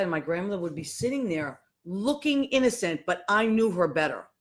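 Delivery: ordinary talk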